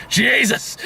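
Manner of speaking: high exasperated exclamation. Real, not acted. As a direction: blurt it out in disbelief. Exhausted after long war movie.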